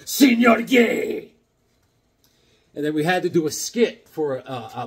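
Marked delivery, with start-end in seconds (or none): 0.0-1.3 slow, drawn-out mock call to the teacher, higher and louder; mocking classroom holler; teasing call-out. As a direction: stretch out the name to needle him. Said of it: "I'd go".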